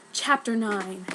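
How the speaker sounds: usual speaking voice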